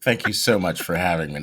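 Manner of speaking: low, just-woke-up voice; morning voice. Real, not acted. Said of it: "that's my morning voice right now".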